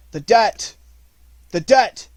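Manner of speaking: high, loud repeated cry; shouting. A narrator's reading. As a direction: yell it across the battlefield. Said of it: "shouted Mowgli".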